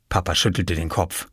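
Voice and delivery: plain reading voice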